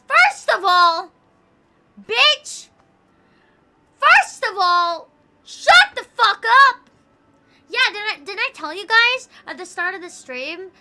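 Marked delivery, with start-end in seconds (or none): 0.1-6.9 slow, high, full-volume screaming at her; drawn-out high shriek of rage; furious yell. Retold or imitated. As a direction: scream every word, spaced out slow. Yelling at her in the story.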